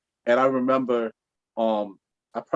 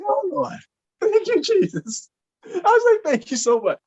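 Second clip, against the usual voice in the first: very high, loud joyful cry; overjoyed thanks; 3 dB louder than usual. Retold or imitated. Suitor thanking Jesus she said yes, high joy. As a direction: shout praise to the Lord.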